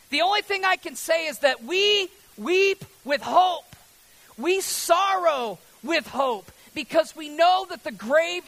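high, loud sermon at full force; impassioned preaching. Real, not acted. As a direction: cry out the hope to the whole congregation.

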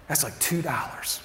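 very soft, low, falling to a whisper; hushed. Acted. Preacher voicing what God is saying about forgiven debt, hushed. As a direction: drop almost to nothing and let it sink in. God's contrast of debts, hushed.

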